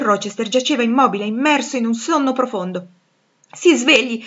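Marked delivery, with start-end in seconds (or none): 3.5-4.2 loud urgent call to wake him; urgent shout. Acted. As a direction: shake him awake with your voice.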